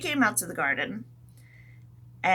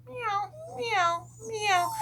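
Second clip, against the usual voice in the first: cat meows; 13 semitones higher, much slower than usual. Pretend. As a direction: mew like a cat. Cat meowing.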